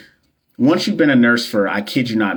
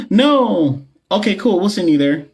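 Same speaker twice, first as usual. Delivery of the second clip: much higher, surprised put-on voice as a director caving; mock boss voice; 10 semitones higher than usual. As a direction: playfully imitate a director giving in at once. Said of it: "And they're gonna be like".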